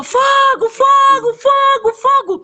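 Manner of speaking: very high, loud repeated shouts; monkey's shrill-pitched fire alarm; alarm cry. Acted. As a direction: cry out a warning to the whole forest.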